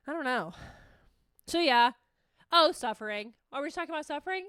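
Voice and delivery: ordinary delivery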